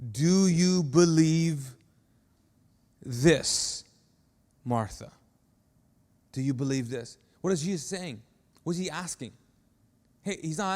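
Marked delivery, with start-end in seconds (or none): 0.1-7.2 tender question. Acted. Jesus questioning Martha, slow.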